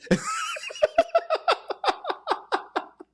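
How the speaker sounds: big high shrieking laugh. Real, not acted. Laughing at baby paw.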